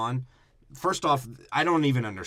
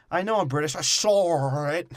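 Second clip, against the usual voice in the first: mock British accent; 6 semitones higher, 4 dB louder than usual. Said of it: "this is how a poor British person talks".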